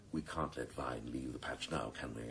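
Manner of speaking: a sly boss whispering the plan; conspiratorial whisper. Acted. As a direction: lean in and whisper it. Scheming, lowering his voice.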